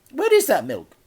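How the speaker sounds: a fussy auntie greeting; Auntie Flip, cheery. A narrator's reading.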